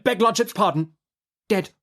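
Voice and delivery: high, quick lawyer's interruption; hasty correction. A narrator's reading. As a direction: cut in too soon. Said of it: "Mister Tangle on his legs again".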